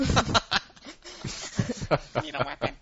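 high talking toy voice; pull string toy. Retold or imitated. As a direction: echo the doll's voice box line. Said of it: "you pull the string back and it goes".

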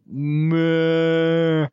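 mooing like a cow. Retold or imitated. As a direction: moo like a startled cow. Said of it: "I only managed to yell".

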